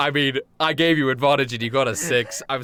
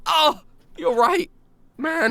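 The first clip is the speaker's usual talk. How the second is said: high, drawn-out pitiful whine; crushed goon; 12 semitones higher, slower than usual. Acted. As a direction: play a goon taking the insult to heart. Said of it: "the goon goes".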